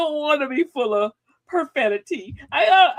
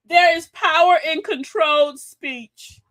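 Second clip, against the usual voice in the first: ordinary talk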